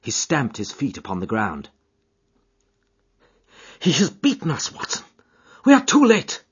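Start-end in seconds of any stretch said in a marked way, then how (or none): 3.8-6.4 high, distraught voice building louder; distracted, despairing outburst; anguished dismay. A narrator's reading. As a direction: build from dismay to a desperate cry. Holmes distracted, stamping, facing defeat.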